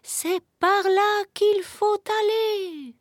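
very high, slow owl voice; guiding owl. A narrator's reading. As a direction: point the way in drawn-out, high owl tones. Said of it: "indiqua la chouette". (voix de chouette aiguë et traînante)

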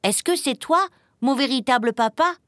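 high, eager questioning voice; eager little one seeking his papa; hopeful little chick. A narrator's reading. Chick hopefully asks frog if father.

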